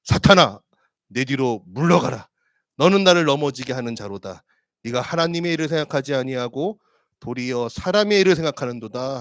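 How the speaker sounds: loud, commanding rebuke; stern rebuke. Acted. Jesus rebuking Peter, loud and stern. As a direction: drive him back with a stern command. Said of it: "돌이키시며 베드로에게 이르시되".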